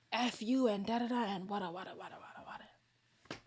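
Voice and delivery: babbled gibberish rant; mock his ranting with nonsense; mock boyfriend rant. Imitating her partner yelling, a gibberish rant. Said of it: "This one".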